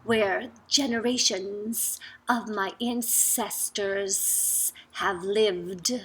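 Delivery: ordinary delivery, nothing marked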